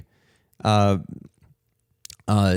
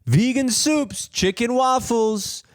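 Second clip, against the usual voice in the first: high, drawn-out mock hawker's call; imitated vendor yell; 10 semitones higher, much slower than usual. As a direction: call out the menu like a market vendor, pitched way up. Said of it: "he's yelling it all out".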